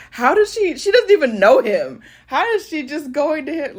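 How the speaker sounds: high, mock-indignant disbelief; indignant grandfathers. Grandfathers complain that the baby runs to a stranger, high and mock-indignant. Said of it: "'cause they were like".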